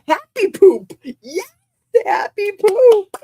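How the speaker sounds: high, loud excited squeal; giddy thrilled squeal; squealing delight. Real, not acted. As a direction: gush over the gift, high and loud. Squealing at live gift.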